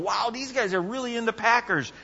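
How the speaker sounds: high, impressed exclamation; amazed wow. Retold or imitated. Preacher watching Packer fans, amazed and high. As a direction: marvel at the fans.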